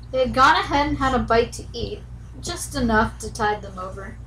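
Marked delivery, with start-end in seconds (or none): none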